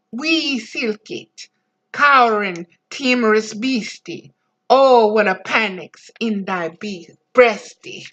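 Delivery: reciting Burns in broad Scots; Burns' mouse poem in a broad Scots accent. Retold or imitated. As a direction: roll it out in broad Scots dialect. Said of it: "painted forever in broad Scotch dialect".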